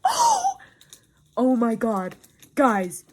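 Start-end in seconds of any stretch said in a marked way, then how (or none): 0.0-0.6 high, loud startled oh; high gasped "oh". Real, not acted. Reader reacting to her book, high shocked gasp.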